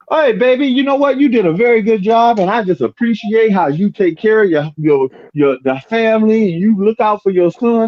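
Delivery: high, sweet praising voice; imitating a doting woman. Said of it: "if you really wanna keep a man".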